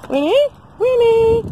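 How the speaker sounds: high, loud call to a pet; calling the dog. Real, not acted. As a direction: sing out the name to fetch a dog.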